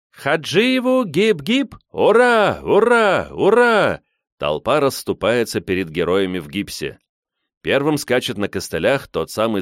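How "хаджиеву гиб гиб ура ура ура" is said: high, loud drawn-out hurrahs; rhythmic hip-hip hurrah shout; crowd cheer. A narrator's reading.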